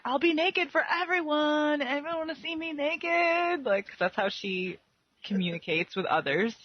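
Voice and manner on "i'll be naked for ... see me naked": high, breathy-free mock seductive voice; a high, hammed-up seductress offering herself. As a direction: vamp it up, high and teasing. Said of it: "crazy lady's like".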